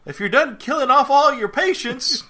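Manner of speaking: high, loud, badly faked sheriff accent; horrible Amos accent. Sheriff barging into the doctor's office, bad loud accent. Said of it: "that's my horrible accent".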